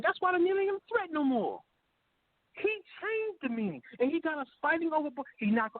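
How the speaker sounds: rising pitch, fired-up rant; heated rant. Real, not acted. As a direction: pour it out, all worked up.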